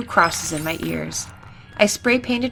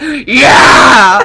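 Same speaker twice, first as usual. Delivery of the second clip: huge, drawn-out roaring shout; hammed-up roar; 6 semitones higher, 13 dB louder than usual. Girl redoing her answer to the boy, mock roar. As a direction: bellow it long and over the top. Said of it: "I roared".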